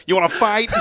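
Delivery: put-on joking Boston accent challenge; Boston tough guy. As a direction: pick a fight like a Boston cat, laughing. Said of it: "did it come back with an accent".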